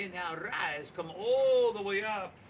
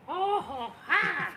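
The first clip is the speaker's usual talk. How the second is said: delighted exclamation; 12 semitones higher, 4 dB louder than usual. Real, not acted. Pleasure in deep forward fold.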